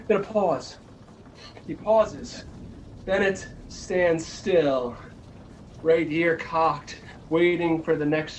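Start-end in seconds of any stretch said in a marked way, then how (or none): none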